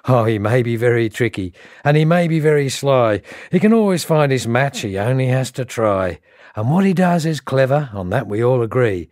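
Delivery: bouncy, emphatic rhymed storytelling; comic bush verse recital. Acted. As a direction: spin the verse like a wry racecourse yarn.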